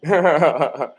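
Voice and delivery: mock call of Johnny breaking into laughter; laughing imitation. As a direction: try the wife's call again but crack up. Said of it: "Like, she sounded like a man".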